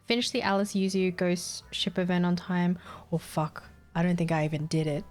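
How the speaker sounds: ordinary talk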